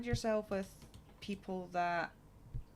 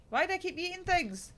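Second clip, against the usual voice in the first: much higher, louder self-directed complaint; exasperated bewilderment; 12 semitones higher, 6 dB louder than usual. Real, not acted. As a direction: cry out, baffled at yourself. Bewildered at own in-game eating.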